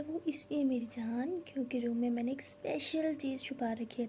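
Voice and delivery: soft teasing whisper to her love. Acted. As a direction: breathe it like a secret. Secret surprise told softly.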